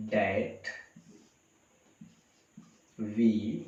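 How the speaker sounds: long pauses between each word, very slow; word-by-word dictation. Shown as a demonstration. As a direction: spell it out for students copying. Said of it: "teacher dictates final sentence". (हर शब्द रुक-रुककर बोलना)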